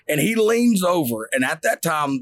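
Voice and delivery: ordinary delivery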